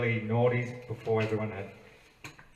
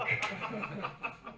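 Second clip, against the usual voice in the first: real laugh; 11 semitones higher, 5 dB quieter than usual. Real, not acted. Laughs after ending.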